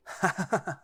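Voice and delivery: put-on a brief amused laugh from the older wolf; amused chuckle. A narrator's reading. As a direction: chuckle at the joke. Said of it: "The male laughs".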